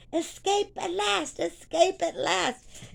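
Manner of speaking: high, drawn-out mock cry of freedom; mock shouting. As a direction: imitate young men calling out, slow and high. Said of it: "these young men are shouting".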